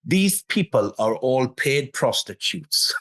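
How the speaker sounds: slow, imitated heavy Geordie accent; a slow Geordie impression of a friend. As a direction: drawl it out like a Geordie mate. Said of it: "in his heavy Geordie accent".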